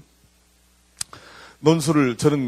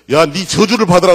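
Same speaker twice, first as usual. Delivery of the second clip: loud, higher shouted curse; mock curse; 5 semitones higher, 9 dB louder than usual. Hebrew man cursing someone, a loud mock shout. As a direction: fling the curse at him.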